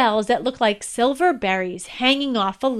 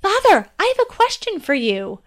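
contained excitement; 8 semitones higher than usual. Acted. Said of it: "trying to control her excitement".

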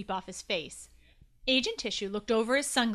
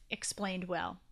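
ordinary delivery, nothing marked

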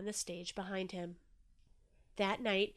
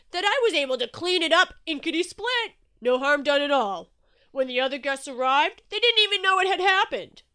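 loud, hearty reassurance; booming Shark; 7 semitones higher, 12 dB louder than usual. A narrator's reading. Shark saying no harm was done, booming. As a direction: belt it out, brushing off the worry.

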